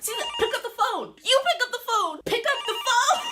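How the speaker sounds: high, loud frantic pleading with a laugh breaking in; half-laughing, half-terrified shouting at her sister; panicked yelling. Retold or imitated. Big sister yelling at Caesar to answer the police, panicked.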